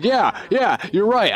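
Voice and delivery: high, quick yes-yes; mock eager agreement. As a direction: customer caving eagerly to the cashier, pitched high. Customer suddenly agreeing.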